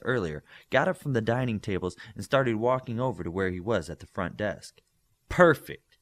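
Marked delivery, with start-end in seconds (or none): 5.3-6.0 higher and louder, exasperated; loud sarcastic thought. A narrator's reading. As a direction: burst out with it in dismay. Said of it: "he thought loudly".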